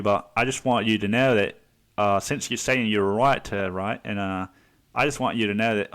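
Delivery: put-on chatty Australian-accented know-it-all. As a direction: do an Aussie bloke offering unasked advice. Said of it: "say it in a fucking Australian accent".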